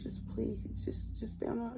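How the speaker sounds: breathy pleading whisper, barely voiced; hushed, begging whisper imitating the ex; desperate whisper. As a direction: beg under your breath. Voicing Tony's desperate plea.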